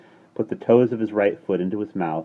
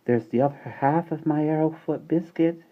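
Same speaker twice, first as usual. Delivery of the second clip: put-on higher, gentle little girl; baby girl voice; 10 semitones higher than usual. A narrator's reading. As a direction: offer it sweetly like a toddler.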